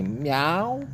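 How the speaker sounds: drawn-out imitated cat meow, rising at the end. Cat pestering the man who cursed it, imitated meow. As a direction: mimic a cat's questioning meow. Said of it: "come here and say".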